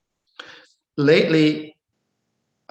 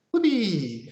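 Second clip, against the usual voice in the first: a higher, playful cry of glee; mock whoopee; 7 semitones higher than usual. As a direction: cheer like a kid on a swing. Mimics inspired person grabbing inspiration.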